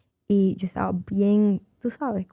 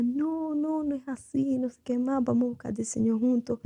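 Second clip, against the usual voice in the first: retold pleading; 4 semitones higher, 4 dB quieter than usual. Girlfriend begging the boy not to leave, soft retold pleading. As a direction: beg softly in a raised voice, easing down gradually.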